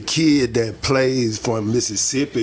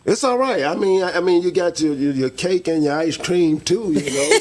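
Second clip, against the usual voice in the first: teasing high pitch; 7 semitones higher than usual. Real, not acted. Joking about his wife on the road.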